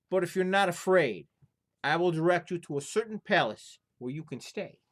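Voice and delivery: a fussy innkeeper refusing a guest. Acted. Innkeeper warns of haunted palace.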